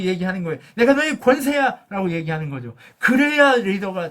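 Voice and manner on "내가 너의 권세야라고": higher and louder, proclaiming 머리야; preacher booming God's words to Moses; God's bold declaration. Acted. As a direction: declare it like God claiming his servant.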